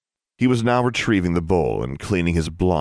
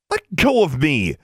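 higher, louder, slow shout; girl shouting at a boy gripping her arm; 9 semitones higher, 3 dB louder, slower than usual. Acted. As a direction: yell at him to let go. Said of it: "she shouted".

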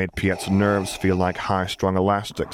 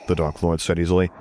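ordinary delivery, nothing marked

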